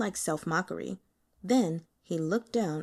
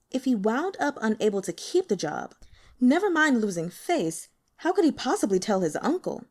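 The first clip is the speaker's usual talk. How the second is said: ordinary delivery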